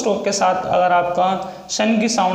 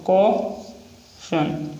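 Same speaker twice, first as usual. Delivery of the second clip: slowed teaching pronunciation; slow drawn-out word; much slower than usual. Shown as a demonstration. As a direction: stretch each syllable slowly while writing. Repeats word while writing.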